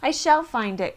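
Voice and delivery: earnest loud promise; determined cry. A narrator's reading. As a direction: declare it, refusing to give up. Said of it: "cried Horton".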